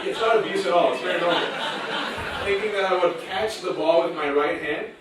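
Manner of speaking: laughing while telling. Real, not acted. Laughing through family story.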